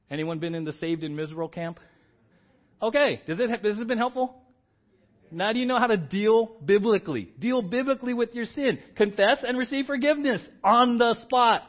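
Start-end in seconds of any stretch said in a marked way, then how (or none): 2.8-11.6 loud, high, ringing sermon climax; full-force preaching. Acted. Pastor urging the church to confess, loud preaching. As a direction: preach it hard and lift the room.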